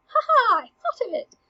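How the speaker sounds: high, loud burst of glee at remembering; triumphant laugh. Real, not acted. Teacher to class, finding the lost word: gleeful, high and loud. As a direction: crow with delight at finally finding the word.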